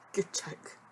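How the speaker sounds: breathy whispered aside, no voice; whispered repeat. Acted. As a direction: repeat "Good joke" as a sarcastic whisper. Repeats the joke remark quieter.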